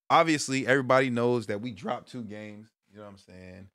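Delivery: usual speaking voice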